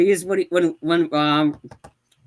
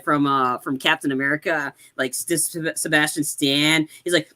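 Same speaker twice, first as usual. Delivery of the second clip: normal talking voice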